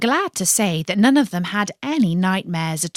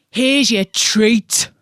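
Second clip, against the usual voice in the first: slow, gruff fish voice; grumpy carp; 2 semitones higher, 4 dB louder, slower than usual. A narrator's reading. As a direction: hand it over grudgingly. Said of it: "said Colin".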